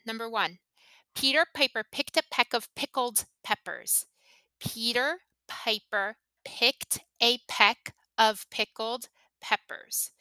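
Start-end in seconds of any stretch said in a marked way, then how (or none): 4.6-10.1 slow, each word set apart; slow word-by-word demo. Shown as a demonstration. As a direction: say the tongue twister slowly, pausing after each word. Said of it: "Listen to the sounds that I'm making".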